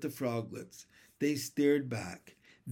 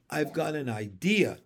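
ordinary delivery

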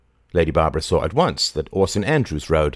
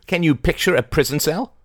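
high, sharp acid question; acidly; 11 semitones higher, 4 dB louder than usual. A narrator's reading. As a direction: sneer it at the intruder. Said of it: "asked Lady Barbara acidly".

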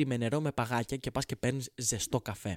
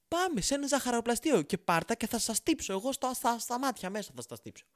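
higher, fast indignant rant; comic rant; 6 semitones higher, 5 dB louder than usual. Real, not acted. Comedian ranting at latte buyers over coffee prices, fast and indignant.

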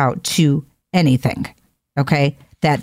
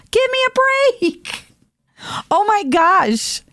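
high, slow, drawn-out disbelief; mocking disbelief, high and slow; exasperated; 13 semitones higher, slower than usual. Real, not acted. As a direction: groan it out in disbelief. Exasperated reaction to own scenario.

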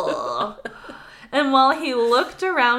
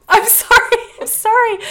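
high, loud giggly sorry; apologizing through laughter, much higher; laughing apology; 12 semitones higher, 8 dB louder than usual. Real, not acted. Caught saying slurp, she apologizes through loud, high laughter.

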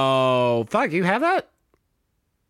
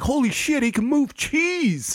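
mock awe; 11 semitones higher than usual. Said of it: "everyone's like".

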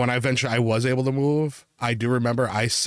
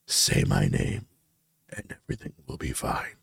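ghostly dream voice; 13 semitones lower, 4 dB quieter than usual. Retold or imitated. Dream figure coaxing the sleeper, creepy low whisper.

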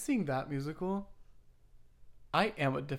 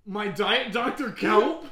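high, loud, slow cartoon voice; SpongeBob character; 6 semitones higher, 10 dB louder, much slower than usual. Doing a SpongeBob impression, high drawn-out cartoon voice. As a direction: stretch it out as an aghast cartoon customer. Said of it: "impression of that one guy from SpongeBob".

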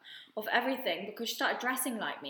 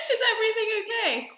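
laughing; 12 semitones higher, 5 dB louder, faster than usual. Retold or imitated. Laughing while quoting a message.

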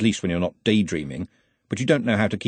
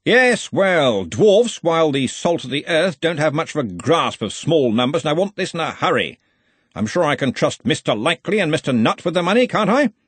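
higher, hearty but pointed; jovial with an edge; 6 semitones higher, 3 dB louder than usual. A narrator's reading. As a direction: bluff joviality with menace underneath. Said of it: "He said it jovially, but there was an edge".

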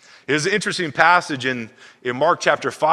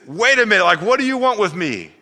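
startled possessed man; 7 semitones higher, 4 dB louder than usual. Acted. Possessed man confronts Jesus.

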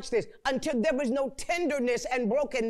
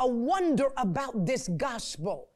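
ordinary talk

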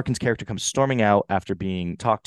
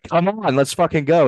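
higher-pitched rallying shout; imitating movie character; 6 semitones higher, 6 dB louder than usual. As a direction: egg them on, loud and high. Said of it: "where he's like".

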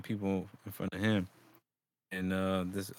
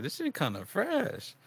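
high slow approval; 10 semitones higher, 3 dB quieter, slower than usual. Retold or imitated. Retold thought about sweater.

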